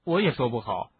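ordinary delivery

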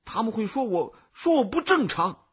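high, loud objection; agitated protest. Acted. Embarrassed boy to the cicada, agitated protest. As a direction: protest loudly, voice pitched right up.

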